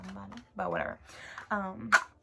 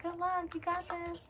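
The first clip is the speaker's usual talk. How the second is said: lightly imitated upbeat nurses, much higher; cheery nurses; 10 semitones higher, 3 dB quieter than usual. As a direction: chirp it like a friendly nurse.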